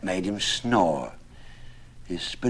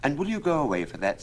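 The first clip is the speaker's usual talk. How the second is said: lightly higher, quick teasing voice; a touch of teasing wife; 8 semitones higher, 3 dB louder, faster than usual. A narrator's reading. Alison teases the suitor at the window, quick and light. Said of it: "said she".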